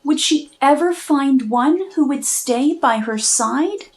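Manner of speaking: ordinary delivery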